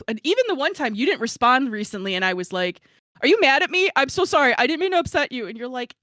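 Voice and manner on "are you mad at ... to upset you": anxious apology. Retold or imitated. Anxious text read aloud.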